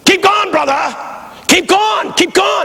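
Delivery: high, hollered encouragement to the preacher; imitated men's yell. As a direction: mimic churchmen urging him on, high-pitched. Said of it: "the men yell".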